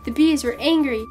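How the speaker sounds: plain reading voice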